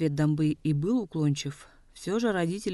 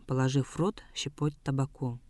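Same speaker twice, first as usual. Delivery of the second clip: plain reading voice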